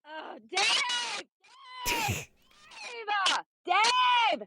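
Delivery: high, loud shout for Dave; stuck in a thorny bush, shouting for Dave; calling out loud. Real, not acted. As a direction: yell his name across the campsite.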